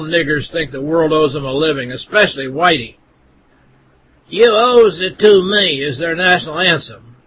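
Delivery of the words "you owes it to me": higher, louder and slow mock voice. Narrator mocking a quoted slogan, slow and higher. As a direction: drag out the jeer in a put-on voice.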